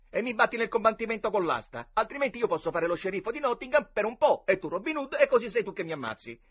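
high-pitched, lightly voiced boy's proposal; persuading boy. A narrator's reading. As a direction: talk your friend into a deal. (ragazzino che propone un accordo)

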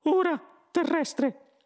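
high, slow, loud mouse voice; Benji. A narrator's reading. Mouse turns to the Earthman, slow squeaky voice. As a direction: address him slowly in a squeak. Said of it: "il topo Benji".

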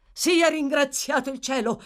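high, loud outburst of thanks; relieved exclamation. Acted. Gentleman hearing she is better, exclaiming. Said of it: "esclamò il gentiluomo".

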